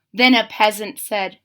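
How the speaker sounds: ordinary delivery, nothing marked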